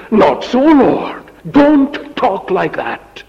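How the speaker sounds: high, loud, slow protest; Peter protesting. Acted. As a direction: cry out against the Lord's words, slowly.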